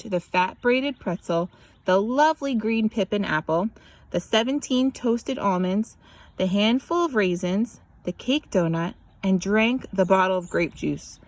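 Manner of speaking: ordinary delivery, nothing marked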